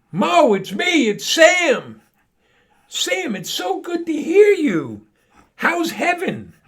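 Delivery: high, loud, slow excited voices; high character voice. Acted. Sam's ghost greets Mo, high and excited. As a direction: play the old friends reunited, pitched high.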